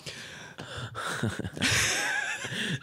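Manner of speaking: high, wheezy laugh; helpless laughter. Real, not acted. Host cracking up after a news clip, wheezy laughter. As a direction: laugh softly with little voice in it.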